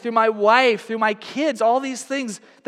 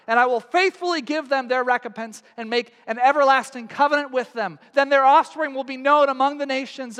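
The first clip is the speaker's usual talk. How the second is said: higher, measured reading of scripture; 5 semitones higher than usual. Acted. Pastor reading Isaiah to the church, solemn. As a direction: read the passage with rising solemnity.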